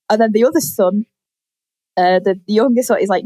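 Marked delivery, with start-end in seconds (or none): none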